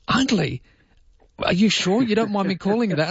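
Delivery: high, disbelieving question; puzzled outsider checking he heard right; incredulous foreigner. Retold or imitated. Overseas person doubting the nickname, high and incredulous. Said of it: "went overseas and they said".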